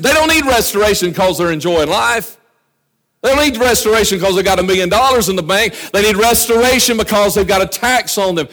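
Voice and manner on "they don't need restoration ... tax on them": ordinary talk, nothing marked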